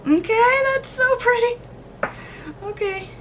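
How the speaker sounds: squealing with delight; thrilled squeal. Real, not acted. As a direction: gush over the color, pitch way up. Delighted by new swatch.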